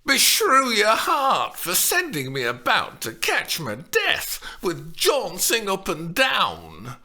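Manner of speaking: loud scolding, slowing at the end; admonishing nurse. A narrator's reading. Old nurse scolds Juliet for sending her running. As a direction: scold the naughty girl. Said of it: "Lynn admonished her".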